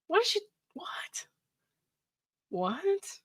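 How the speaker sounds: a breathless whispered what, what; stunned whisper. Real, not acted. Stunned by his ex's text, whispered disbelief.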